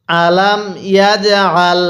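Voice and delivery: slow "আলাম ইয়াজআল", bounced "j"; model recitation. Shown as a demonstration. Teacher has learners read along, slow measured "আলাম ইয়াজআল". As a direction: draw out "আলাম ইয়াজআল" syllable by syllable. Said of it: "হ্যাঁ, পড়ুন".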